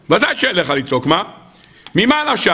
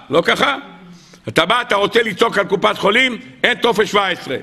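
ordinary delivery

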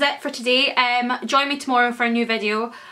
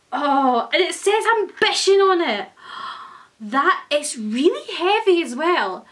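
thrilled gasp; 5 semitones higher, 3 dB louder than usual. Real, not acted. Freaking out over the coin.

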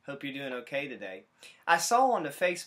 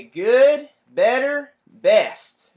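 much louder, much higher cheer; all the bugs cheer together; triumphant chorus; 11 semitones higher, 18 dB louder, much slower than usual. Acted. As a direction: cheer each word like a finale. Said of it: "And now they say".

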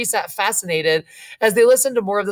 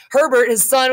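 ordinary delivery

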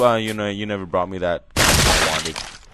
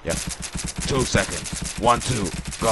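normal talking voice

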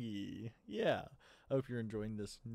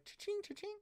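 high cha-ching. Acted. Sound of counting gold.

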